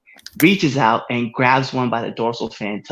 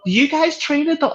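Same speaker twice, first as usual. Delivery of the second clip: mock lady; 13 semitones higher than usual. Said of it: "she goes".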